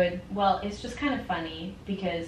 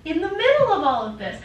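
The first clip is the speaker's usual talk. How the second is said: usual speaking voice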